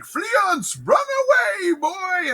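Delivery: very high, strained mock shout; hammed-up dying cry. Dying Banquo warning his son to flee, hammed-up high cry. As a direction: cry out a comic last warning. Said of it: "with Banquo's dying breath".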